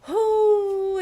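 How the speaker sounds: high, loud excited cry; squealing oh of excitement. Real, not acted. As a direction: let out a thrilled squeal. Excitement about the coming mess.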